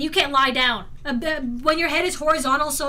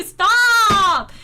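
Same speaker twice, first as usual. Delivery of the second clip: loud, drawn-out exasperated order; high, loud mock protest; mock scolding; 4 semitones higher, 7 dB louder than usual. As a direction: shout it at a pestering crowd. Scolds chat for spamming meme.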